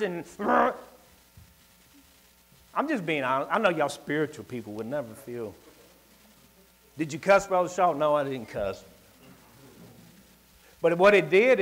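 normal talking voice

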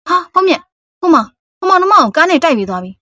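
urgent, startled report; alarmed. Acted. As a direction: blurt out the shock of seeing an accident. Witnesses a hit-and-run.

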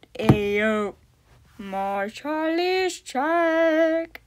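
very slow stretched voice; drawn out. Pretend. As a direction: drag every word out. Uitgerekte stem. (heel traag uitgerekt)

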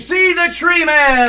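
high sideshow pitch; barker's call. Acted. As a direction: sell the sideshow freak. Barker drums up a crowd.

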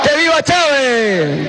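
slow rousing rally shout; booming balcony speech. Real, not acted. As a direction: drag it out for the crowd. Rally chant of vivas.

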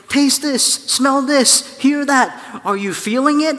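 high and loud, thrilled and urgent; excited God. Acted. God urging Adam and Eve to taste and feel, excited. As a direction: call out eagerly like a delighted guide. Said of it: "running around saying".

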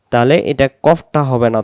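ordinary talk, nothing marked

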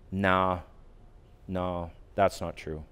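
soft, low, gentle correcting thought; calm new inner voice. Retold or imitated. The gentle new voice answering his angry thought, soft and calm. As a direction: settle the anger quietly. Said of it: "another voice happened".

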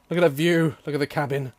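ordinary delivery

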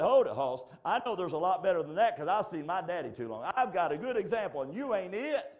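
loud, emphatic put-down, climbing high; defiant daughter. Acted. Little girl rejecting a suitor, loud and defiant. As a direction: send him packing at full volume.

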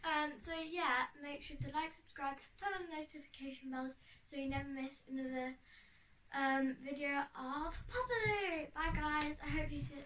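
usual speaking voice

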